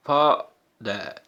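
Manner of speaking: Father said slowly as फा, pause, दर; two separate beats on father; syllable by syllable. Shown as a demonstration. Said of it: "टू बार effort करना पड़ रहा है". (Father को दो हिस्सों में रुककर)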